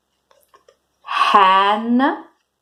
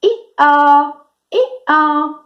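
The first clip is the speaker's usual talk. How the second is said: high two-part hee-haw; donkey bray; 6 semitones higher than usual. Shown as a demonstration. Teacher giving kids a clue for the donkey, braying. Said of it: "The donkey says".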